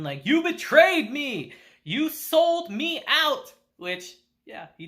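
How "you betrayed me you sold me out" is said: raised, high-pitched outburst, drawn out; slow, high cry of betrayal, acted out; mock scream. Kushida confronts Ryun over the betrayal, mock screaming. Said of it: "she starts screaming at Ryun".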